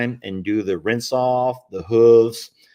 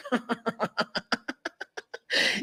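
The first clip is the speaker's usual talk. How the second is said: high, quiet wheezing giggle; laughter; 11 semitones higher, 7 dB quieter than usual. Real, not acted. Tickled by the toddler's British accent, high giggling. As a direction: crack up helplessly in short bursts.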